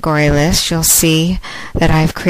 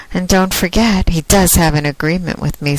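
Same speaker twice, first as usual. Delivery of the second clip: ordinary delivery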